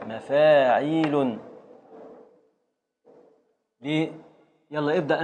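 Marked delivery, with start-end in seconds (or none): 0.1-1.4 "مفاعيل" very slow, long vowels held; drawn-out teacher's pattern word; metrical demonstration. Shown as a demonstration. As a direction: stretch out each syllable of "مفاعيل". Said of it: "ثم تأتي التفعيلة الثانية".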